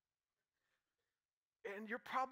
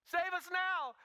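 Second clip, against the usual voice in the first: crowd's demand; 7 semitones higher, 5 dB louder, slower than usual. Acted.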